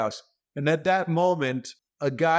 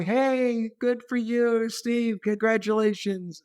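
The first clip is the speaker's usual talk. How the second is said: high, eager congratulations; excited passengers; 8 semitones higher, slower than usual. Retold or imitated. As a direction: call out to a famous face. People are pointing.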